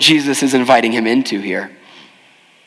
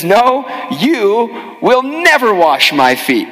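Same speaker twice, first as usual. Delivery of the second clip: never stretched out higher and slower; emphatic refusal; 6 semitones higher, slower than usual. Retold or imitated. Peter refusing Jesus at the footwashing, slow emphatic never. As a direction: land hard on never, refusing slowly. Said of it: "in typical Peter fashion".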